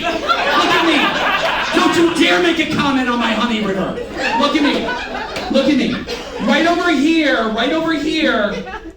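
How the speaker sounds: loud high playful yelling with laughter; hammed-up demand for Stan's attention; mock outraged shout.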